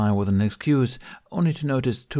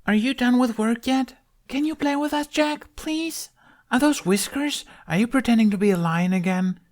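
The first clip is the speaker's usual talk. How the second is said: put-on very high kid's voice; eager child; 3 dB louder than usual. A narrator's reading. Kids clamoring for Jack's attention, high pleading. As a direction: pester like an excited little kid.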